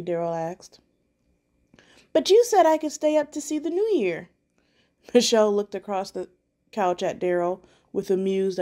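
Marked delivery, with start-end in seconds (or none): 2.1-4.3 put-on child pleading. A narrator's reading.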